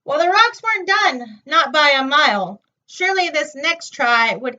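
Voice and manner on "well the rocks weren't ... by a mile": plain reading voice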